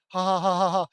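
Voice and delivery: hammed-up fake laughing; loud mock laughter. A narrator's reading. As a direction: bark out a sarcastic laugh. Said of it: "Dawn laughed".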